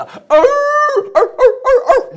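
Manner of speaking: high, loud dog barks; frantic dog barking for its master. Acted. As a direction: bark the alarm at the door.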